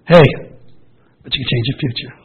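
normal talking voice

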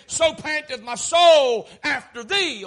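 impassioned psalm quotation, drawn out; fervent cry. Acted. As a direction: stretch it out loud, pleading upward to God. Preacher voices the psalmist's longing cry.

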